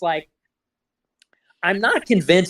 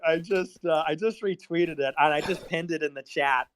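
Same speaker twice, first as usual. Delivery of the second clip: ordinary talk, nothing marked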